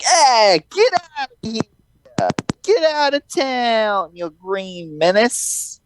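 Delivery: high, drawn-out New Yorker jeer; mock heckling crowd. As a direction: holler slowly in a high put-on voice. Voices New Yorkers heckling the Goblin.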